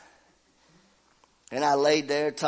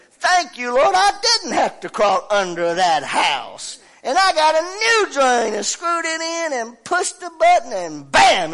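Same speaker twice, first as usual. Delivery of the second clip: "Thank you, Lord" shouted much higher and louder; triumphant shout; 12 semitones higher, 10 dB louder than usual. Real, not acted. Preacher celebrating to the church that he didn't have to crawl under the house, "Thank you, Lord" shouted.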